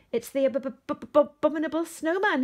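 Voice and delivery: high, loud terrified stammer; panicky stutter. A narrator's reading. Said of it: "he stuttered".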